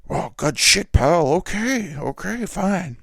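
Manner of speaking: big, loud, high-pitched mock boss voice; Vince McMahon. Boss accepts a billion dollars for old footage, hammed-up loud impression. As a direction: bellow it like a blustering company boss. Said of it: "And Vince is like".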